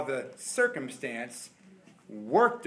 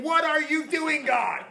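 higher, slow cry; mock panic; 10 semitones higher, 4 dB louder, slower than usual. Believer flipping out at God in the car, mock panic. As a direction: flip out in the car.

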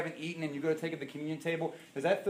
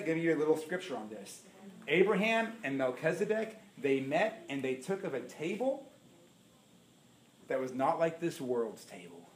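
ordinary talk, nothing marked

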